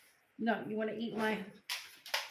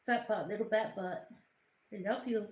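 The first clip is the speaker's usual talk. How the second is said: ordinary talk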